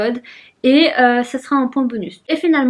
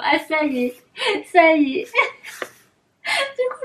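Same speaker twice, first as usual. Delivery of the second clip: laughing; 5 semitones higher than usual. Real, not acted. Struggling, laughing at box.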